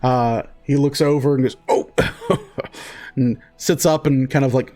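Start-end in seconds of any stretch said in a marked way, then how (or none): 1.7-2.8 a high, delighted burst of laughter; a sudden jolly dwarf's laugh; surprised laugh. Acted. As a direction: laugh as if caught off guard and pleased. Dwarf notices the daughter.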